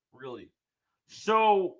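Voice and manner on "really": Really? said low and much softer; flat deadpan really. Real, not acted. After the super chat, a soft, flat deadpan Really?. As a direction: let Really? drop, flat and quiet. Repeats, deflated second take.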